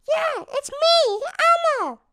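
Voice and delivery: very high, slow puppet squeak; Elmo voice. Pretend. Showing what not to say on a date, high slow Elmo voice. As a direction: draw out Elmo's voice high and slow. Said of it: "my Elmo voice".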